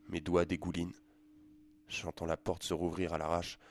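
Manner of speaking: usual speaking voice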